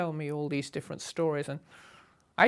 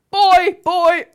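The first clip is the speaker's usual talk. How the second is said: barked much louder and higher, summoning staff; imperious colonial call; 13 semitones higher, 15 dB louder than usual. Retold or imitated. Hugh summoning the yacht club staff, loud imperious call. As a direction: call the servant like an old colonial. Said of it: "he'd clap his hands".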